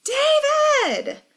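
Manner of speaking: very high, panicked shout; desperate high-pitched call. A narrator's reading. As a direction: yell the name for rescue. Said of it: "she shouts".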